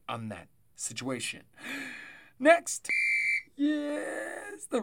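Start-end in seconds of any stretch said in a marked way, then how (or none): 1.5-4.8 gasp, then high loud burst; excited. Real, not acted. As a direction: jump into the next game with glee. Excited segment transition.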